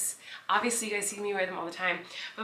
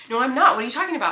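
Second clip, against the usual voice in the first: louder, higher, protesting; roommate's loud denial; 5 semitones higher, 9 dB louder, much faster than usual. Retold or imitated. Roommate telling her she was not in bed, loud protest. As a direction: insist loudly that you were never there. Roommate denies being home.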